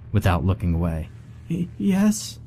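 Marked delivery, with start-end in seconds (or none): 1.5-2.4 high, frightened stammer. A narrator's reading. Terrified Monica stammers an answer to Ellie. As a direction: stutter out a scared answer. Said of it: "she stammered".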